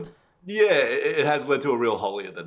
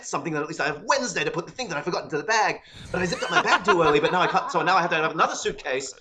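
frantic inner monologue; 6 semitones higher, 4 dB louder than usual. Retold or imitated. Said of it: "And I'm like".

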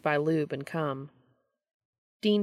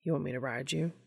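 soft, quiet question; asking quietly; 2 semitones lower, 6 dB quieter, faster than usual. A narrator's reading. Dean looking up at Cass, asking quietly. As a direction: ask it softly, for one listener only. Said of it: "asking quietly".

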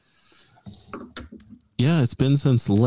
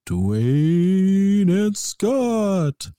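drawn-out high spooky intro; spooky announcer; 8 semitones higher, much slower than usual. Acted. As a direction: stretch the names like a ghoul.

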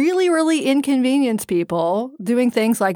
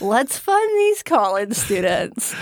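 slow, drawn-out mock executive voice; hammed-up executive selling a scheme; scheming oil executive; slower than usual. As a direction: stretch every word out slowly, playing the plotter. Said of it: "oil executives sitting around going".

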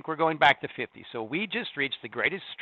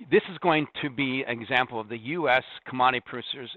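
ordinary talk, nothing marked

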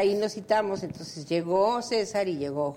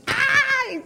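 a high, loud ay of despair; mock cry of despair; 7 dB louder than usual. Retelling Rubén's despair, a high loud ay. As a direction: squeal ay out in anguish.

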